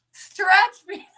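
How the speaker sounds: real high-pitched, breathless laughter through the words; helplessly cracking up; laughing hard. Real, not acted. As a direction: barely get it out for laughing. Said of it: "I'm laughing so hard".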